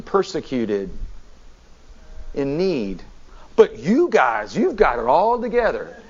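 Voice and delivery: mock-admiring, swooping sarcastic praise. Preacher voices Paul chiding the smug Corinthians, sarcastic. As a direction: lay on the flattery with obvious sarcasm. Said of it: "the sarcasm in his voice".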